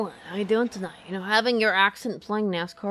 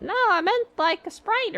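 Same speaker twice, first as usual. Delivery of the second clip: high, whiny mock customer correcting the order; 13 semitones higher, 4 dB louder than usual. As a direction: play a clueless customer, voice pitched up. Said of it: "when someone says".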